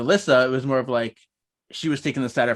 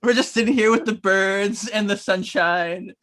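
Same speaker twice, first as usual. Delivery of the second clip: slow, high, sing-song daydreamer; dreamy Phoebe; 6 semitones higher, 4 dB louder, slower than usual. Retold or imitated. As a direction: gush over the picnic, slow and high. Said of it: "Phoebe is like".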